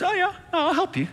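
high, gentle reassurance; tender God voice. Acted. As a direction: coax them like a kind father. God voiced comforting a sufferer.

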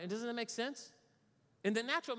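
normal talking voice

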